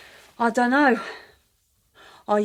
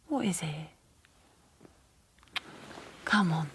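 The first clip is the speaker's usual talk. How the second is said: whisper; 2 semitones lower, 6 dB quieter than usual. Acted. Woman urging the party man closer, in a whisper. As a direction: coax him quietly, under the breath.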